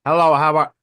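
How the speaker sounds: Chinese movie imitation. Shown as a demonstration. Showing friends a Chinese movie, mock movie voice. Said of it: "here's a Chinese movie".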